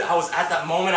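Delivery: ordinary talk, nothing marked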